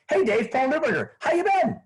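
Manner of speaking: put-on high, bright friendly hello like an old pal; upbeat familiar greeting. Shown as a demonstration. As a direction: greet him like you already know him. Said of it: "if I just say".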